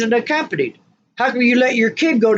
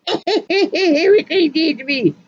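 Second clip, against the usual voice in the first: mocking laugh; 8 semitones higher than usual.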